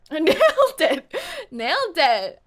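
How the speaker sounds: loud, laughing stab at British; mock British accent. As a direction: crow it in put-on British. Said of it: "Am I fluent in British now?".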